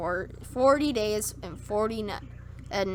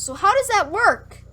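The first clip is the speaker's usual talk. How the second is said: high-pitched, louder questioning; incredulous challenge; 10 semitones higher, 6 dB louder than usual. Real, not acted. Reader questioning where the water came from, incredulous. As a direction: push the question up in baffled protest.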